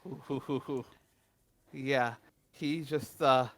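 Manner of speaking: ordinary talk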